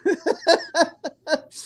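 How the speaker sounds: high, sustained real laugh; laughter. Real, not acted. Host tickled by a comment on his accent, laughing. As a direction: let the giggles run out.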